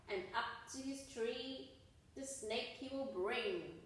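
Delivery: usual speaking voice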